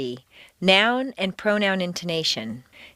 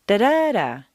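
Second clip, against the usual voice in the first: long, raised middle "da" between short ones; stress on middle syllable; 2 semitones higher, 3 dB louder than usual. Shown as a demonstration. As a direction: punch the second "da". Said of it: "keeping the same intonation pattern".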